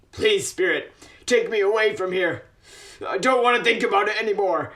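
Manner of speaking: distressed plea. Acted. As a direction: rush it out, desperate to escape the memory. Begging the spirit.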